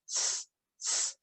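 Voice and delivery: unvoiced s hiss; just hiss an s. Shown as a demonstration. Teacher showing the z sounds like s, a hiss. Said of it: "It sounds like".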